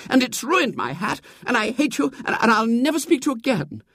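high, indignant tirade; angry. A narrator's reading. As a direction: rage at him, high and gathering speed. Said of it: "said Marian angrily".

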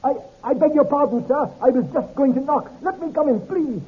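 high, pleading, terrified stranger; cringing, frightened man. Acted. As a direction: beg in a high, panicky voice. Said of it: "his manner cringing".

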